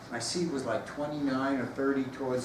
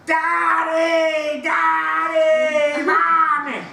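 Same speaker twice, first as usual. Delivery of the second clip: high, loud mock child yelling for his parents; a child screaming for daddy and mommy on a plane; 12 semitones higher, 9 dB louder, much slower than usual. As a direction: belt it like a wailing toddler. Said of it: "he's screaming so loud".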